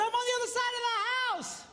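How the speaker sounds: high, fast holler; retold far-room yell; yelling back. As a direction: shout it across the house. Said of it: "She yells back".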